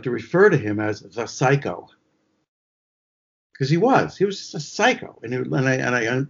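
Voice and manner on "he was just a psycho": high-pitched emphasis on just; stress on just. Real, not acted. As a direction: lean on the word just. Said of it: "emphasize the word just".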